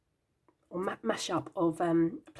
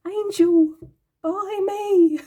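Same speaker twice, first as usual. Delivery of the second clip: higher, louder luring call; deck calling out; 12 semitones higher, 11 dB louder, much slower than usual. Retold or imitated. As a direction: voice the deck begging to be bought. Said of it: "It was like".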